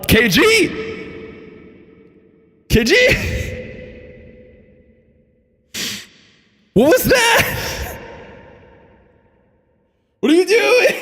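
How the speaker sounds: high, disbelieving shout; excited shriek. Real, not acted. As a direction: yell at the donor in shock. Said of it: "I'm trying not to scream any louder".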